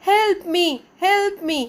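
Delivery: high, calling out help me; elf calling for help. Acted. Elf calling to Patrick for help. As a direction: call out like a needy little elf. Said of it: "और वो बुलाता था".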